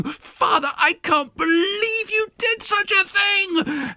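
very high, drawn-out indignant cry; outraged daughter wailing at her father; angry outburst. A narrator's reading. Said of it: "she's now angry and glaring at him and saying".

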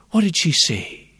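ordinary delivery, nothing marked

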